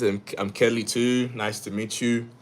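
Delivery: usual speaking voice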